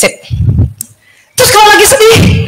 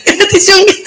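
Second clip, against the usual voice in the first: higher, half-laughing repeat; laughing echo; 7 semitones higher than usual. Retold or imitated. The speaker repeating the friend's request, higher and laughing. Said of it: "Kecuali dia minta".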